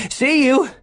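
high, loud breathless call; breathless shouted farewell; panted. A narrator's reading. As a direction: call it out high while panting. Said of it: "Gates panted to Alan".

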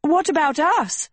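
high, desperate call; screamed protest. A narrator's reading. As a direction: cry after someone who is leaving, pitched high. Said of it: "screamed Agatha".

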